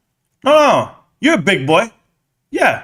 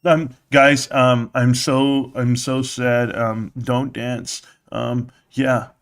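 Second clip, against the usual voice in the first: mock sadness; 8 semitones lower, 5 dB quieter, much slower than usual.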